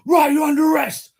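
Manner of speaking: high, loud mock cop; hammed-up policeman. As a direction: parody the arresting officer, shrill and loud. Mocking police arresting him.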